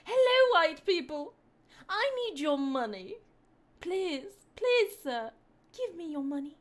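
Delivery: high-pitched, over-polite begging; hammed-up polite beggar; mock proper English. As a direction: plead in exaggerated proper English, pitched right up.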